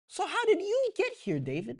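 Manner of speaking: high, mocking big brother; Eliab scolding. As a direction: needle the little brother. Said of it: "That's what he's basically saying".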